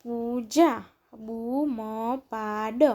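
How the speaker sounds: પૂજા, બૂમ પાડ with each syllable held. Shown as a demonstration. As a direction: sound out પૂજા, બૂમ પાડ, pausing between words. Syllable-by-syllable reading, then blended words.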